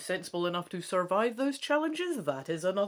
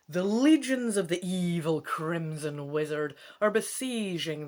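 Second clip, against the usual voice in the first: louder, announcer-like read of the back cover; blurb reading; 4 dB louder than usual. Acted. Gamer reading the book's back cover to viewers, announcer style.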